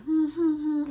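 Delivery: loud, high mocking hmm hmm hmm; skeptical hums. Answering his promises with loud disbelieving hums. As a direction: hum loudly, doubting every word. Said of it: "So I'm just like".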